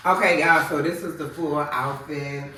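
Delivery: normal talking voice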